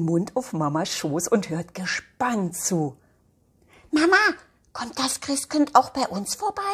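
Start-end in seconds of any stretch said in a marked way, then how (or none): 3.9-6.6 excited question. Acted. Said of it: "fragt das Mädchen ganz aufgeregt".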